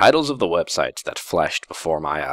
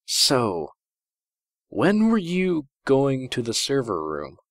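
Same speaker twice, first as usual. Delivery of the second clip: sly princess; 7 semitones higher, slower than usual. Acted. Princess slyly asks.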